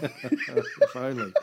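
wry, slow laugh through the words. Real, not acted. Host laughing at his cohost's dark joke, slow chuckle. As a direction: chuckle it out slowly. Joking about Hitler's book sales.

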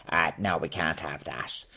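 low, soft mock Dublin official accent; a stuffy Dublin official refusing, in a Dublin accent. Comedian voicing the Office of Public Works, Dublin accent. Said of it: "talk in Dublin accents".

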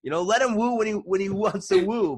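excited, laughing retelling. Real, not acted. Teller recaps a funny story to friends, high and excited.

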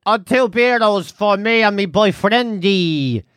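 mock tourist talking to locals; sitcom tourist voice. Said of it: "that thing that you see in sitcoms".

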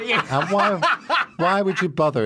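mock outrage, breaking into laughs; laughing. Real, not acted. Teasing the hosts' shirts.

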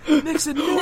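high-pitched mock cry of dismay; mock horrified cry. Movie hero sees old Nixon on TV, mock horrified. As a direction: cry out in hammed-up horror. Acts hero's horror at seeing Nixon.

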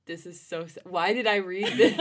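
ordinary talk

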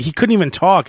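ordinary talk

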